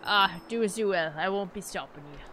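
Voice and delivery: put-on dismissive villager, British accent. Reads villager dialogue in accent.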